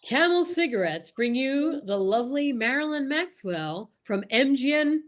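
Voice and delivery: normal talking voice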